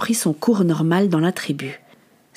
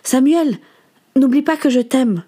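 higher-pitched call after someone leaving; fond, carrying farewell; affectionate call; 5 semitones higher than usual. Acted. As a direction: call out your love as they go. Aunt calls after departing boy.

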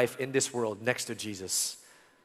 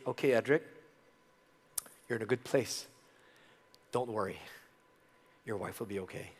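comforting voice; 3 semitones lower, 7 dB quieter, faster than usual. Retold or imitated. God's comforting words retold.

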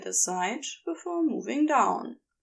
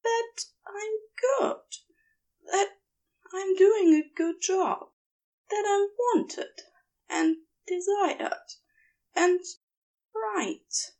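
raised, earnest, unsure angel voice; pleading angel; 10 semitones higher than usual. Acted. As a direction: ask to be told what to do, hesitantly.

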